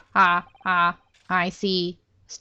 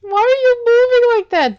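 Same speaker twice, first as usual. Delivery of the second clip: much higher, louder baffled question; loud baffled shout; 8 dB louder than usual. Real, not acted. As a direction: yell it, totally baffled. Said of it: "they're really showing off their animation".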